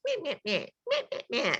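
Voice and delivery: mock the nagging voice in your head; nagging little voice. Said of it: "It's that little voice".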